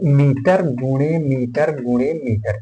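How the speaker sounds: units drawn out while writing; slow dictation. Real, not acted. Teacher writing out units of volume, slow dictation. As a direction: say each unit slowly, at writing speed.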